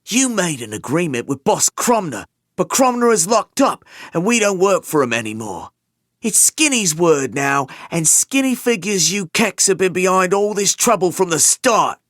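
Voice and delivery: loud, high, sneering gangster voice; menacing thug. Acted. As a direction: threaten him from above. Thug threatening beaten man.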